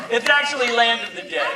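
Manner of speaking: normal talking voice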